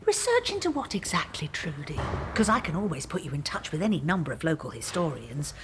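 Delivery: usual speaking voice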